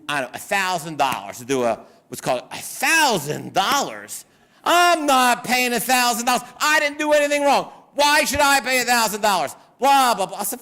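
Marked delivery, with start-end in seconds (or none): 2.5-4.2 mock-shocked repeat of the price; incredulous echo. Client hearing the lawyer's price, incredulous. 4.6-10.4 high-pitched mock outrage; indignant protest. Client refusing to pay a thousand dollars, indignant.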